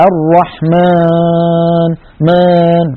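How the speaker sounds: الرحمن with a long nasalized alif, pushed through the nose; nasal alif demo. Shown as a demonstration. Teacher demonstrating a common error: nasal alif. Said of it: "تجد بأنه ضاغط على أنفه".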